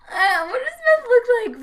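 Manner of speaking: high laughing question. Real, not acted. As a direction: laugh through the words. Laughing at the bit.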